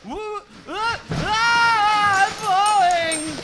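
high, loud startled cry; falling yelp. Acted. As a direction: cry out while toppling over. Falling down the stairs.

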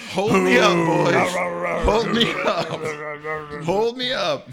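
high, loud rallying cry; triumphant shouting. Acted. Lifted by union, triumphant yelling.

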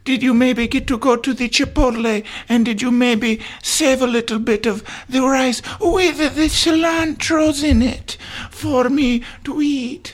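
high put-on kid voice; pleading child. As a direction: wheedle papa for a treat as a small child. Second child begs papa for food.

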